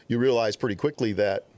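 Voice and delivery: ordinary delivery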